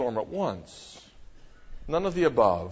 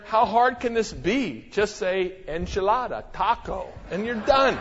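breezy dismissal; 6 semitones higher than usual. Retold or imitated. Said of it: "I said to her".

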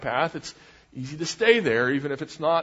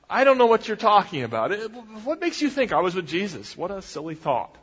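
higher, quicker feigned puzzlement; playing dumb; 6 semitones higher, 3 dB louder than usual. Peter denying he was with Jesus, playing dumb. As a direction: act baffled and brush the question off. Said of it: "playing dumb".

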